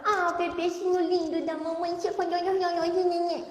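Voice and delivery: put-on high cooing baby talk, garbled on purpose; babytalk. Shown as a demonstration. Therapist shows parents wrong baby talk, high and cooing. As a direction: coo at the baby with high nonsense sounds. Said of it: "Eu falar assim".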